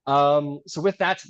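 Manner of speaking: ordinary talk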